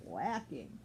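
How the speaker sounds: usual speaking voice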